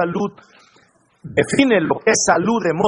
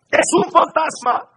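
frightened cry; 10 semitones higher than usual. A narrator's reading. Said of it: "y de miedo se pusieron a gritar".

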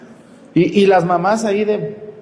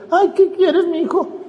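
high, sugary mock mom voice pitched way up, with a laugh; mock doting mother; 11 semitones higher, slower than usual. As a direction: parody a silly fussing mother.

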